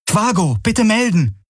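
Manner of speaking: very high, urgent hail; calling out. A narrator's reading. Kirai hails the ship through static, calling urgently. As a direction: call for a reply over the comm, pitched high. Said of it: "rief Kirai in das Komm".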